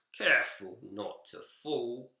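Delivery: plain reading voice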